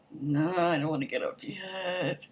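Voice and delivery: slow, groggy mock protest; reluctant whine. To whoever woke them on vacation, whining reluctantly. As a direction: play a sleepy, dragged-out complaint. Acts being woken by alarm.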